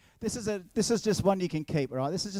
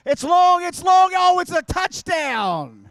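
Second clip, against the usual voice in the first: much higher and louder, with a slow, drawn-out touchdown call; mock commentator; 13 semitones higher, 11 dB louder, much slower than usual. Pretend game commentator calling his own throw as a touchdown, hammed up. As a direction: hype it up like a play-by-play caller.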